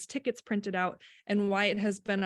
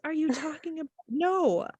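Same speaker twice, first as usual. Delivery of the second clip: higher, puzzled mock reply; baffled bystander; 7 semitones higher than usual. Mother retelling a funeral guest's blank response, mock bafflement. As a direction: imitate a baffled onlooker. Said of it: "looking at me like".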